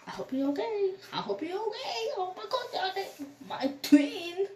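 high, pleading voice of the sister; lightly voiced upset sister; sister in tears.

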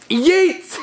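high, excited throwing cry; gleeful yeet. Acted. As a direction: fling it away with the word. Throwing the paper away.

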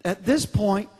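ordinary talk, nothing marked

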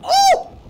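high, loud "oh" of alarm; sudden real shriek; startled yell. Real, not acted. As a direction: jolt and cry out. Startled again.

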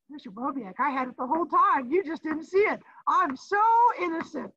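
high, rising whiny student protest; teacher mimicking Sydney pleading innocence; mock student voice. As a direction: play the kid claiming innocence.

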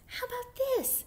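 high, tiny mouse voice; mouse under pillow. A narrator's reading. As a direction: ask in a small high squeak. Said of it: "said from under his pillow".

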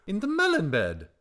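high, loud singsong bird; excitable bird, pitched well up; Darzee. A narrator's reading. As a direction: sing out the answer.

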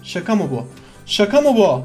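usual speaking voice

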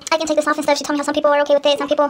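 ordinary talk

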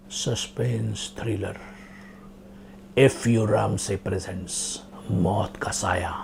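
low, slow, whispery title announcement; old thriller trailer voice; suspense film announcer. Acted. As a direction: draw out the title in an ominous hush.

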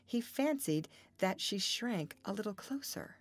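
ordinary delivery

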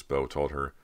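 usual speaking voice

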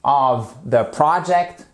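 slow "project" as PRAH-ject; correct pronunciation. Shown as a demonstration. Teacher repeats the right form for learners, slow pronunciation. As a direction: open the first vowel of "project". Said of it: "et pas project".

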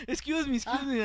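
high, meek little apology; mock politeness. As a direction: squeeze past with an over-polite plea. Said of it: "y todavía le decimos". (disculpa tímida y aguda, exagerada)

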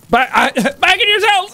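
laughing through a high command; guard order cracking into laughter; prison guard laughing. Acted. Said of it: "Running away".

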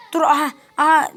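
loud alarmed aha; sudden cry of alarm; startled shout. Real, not acted. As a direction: caught out, yelp it. Spotted by enemy in game.

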